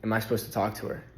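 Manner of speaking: hushed, quick, low voice; retold near-whisper. Narrator retells asking spirit guides on the plane, near-whisper. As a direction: say it softly and quickly, as if half-whispering. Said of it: "I kinda whisper it".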